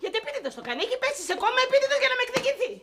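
high, breakneck outraged questions; hysterical rant. Acted. Actress raging over the writer's collapse, hysterical. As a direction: spiral upward in frantic indignation. Actress rants after being told to calm down.

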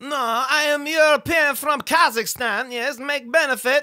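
comic Kazakh character voice; Borat. Acted.

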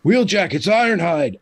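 urgent radio call. Pretend. Game master as Ironhide bursting from the walkie-talkie, calling out. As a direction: call out a name as if over the radio. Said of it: "it bursts to life with".

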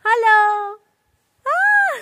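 high loud call into the valley; cathedral echo. Real, not acted. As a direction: shout hello to test the echo. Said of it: "ইকো হচ্ছে".

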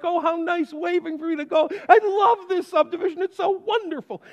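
high, delighted gush over the friendly neighbor; naive delight. Retold or imitated. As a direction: gush happily, pitch way up. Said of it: "I'm like".

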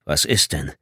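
low, grumpy question; surly, faintly afraid. A narrator's reading. Scott asking Tommy what is wrong, surly. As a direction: grumble it with a trace of fear. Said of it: "In die mürrische Frage mischte sich eine Spur Angst".

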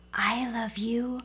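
soft unvoiced bedtime whisper. Big Nut Brown Hair whispers to sleepy Little Nut Brown Hair at bedtime. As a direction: breathe it to a sleepy child. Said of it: "whispered with a smile".

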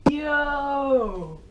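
high stunned cry of what; shocked. Real, not acted. Vlogger rewatching the ending, high stunned shout. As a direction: blurt out disbelief.

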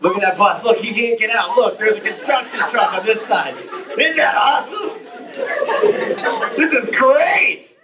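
delighted commentary, laughing at the show; enjoy the spectacle like quality TV; amused, half-laughing delight; gleeful amused onlooker. Retold or imitated. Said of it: "I imagine he was up there going".

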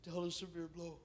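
soft, subdued admission of pain; somber lament. Acted. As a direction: say it very quietly, heavily. Somber echo of earlier cry.

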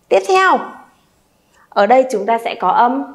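normal talking voice

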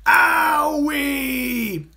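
high, loud yelp of pain; a crocodile knocked flying; pained cry. A narrator's reading. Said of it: "cried the crocodile".

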